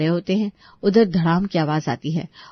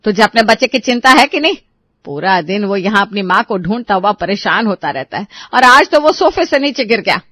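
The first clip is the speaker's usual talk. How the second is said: loud accusing outburst, peaking at the end; angry scolding; 5 dB louder than usual. Acted. Asha blames daughter-in-law for the child's fall, angrily. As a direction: berate her for neglect.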